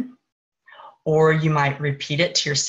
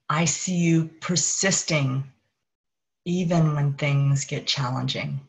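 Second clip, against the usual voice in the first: higher consciousness voice. Acted. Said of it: "the voice of higher consciousness".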